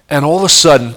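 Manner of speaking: ordinary talk, nothing marked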